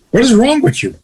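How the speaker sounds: high-pitched, incredulous rebuke; acted outrage, pitch jumping up; indignant scolding. Shocked friend scolding a husband about his wife, indignant.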